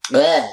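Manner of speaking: short, low gagging noise; mock retch at a foul taste; imitated disgusted "bleh". Imitating a student eating a cursed finger, comically disgusted.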